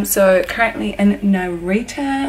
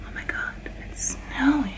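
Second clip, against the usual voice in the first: softer, breathless whisper of wonder; awed whisper; 11 dB quieter than usual. Real, not acted. Seeing snow and whispering to viewers in wonder.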